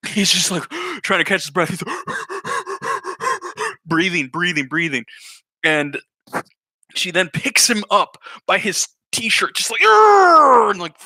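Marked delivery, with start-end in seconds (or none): 1.9-3.8 high strained gasp for air; mock gasp. Kid trying to catch his breath, gasping. 9.8-10.7 loud high grunt of effort; mock effort growl. Secretary hoisting kid by shirt, growling.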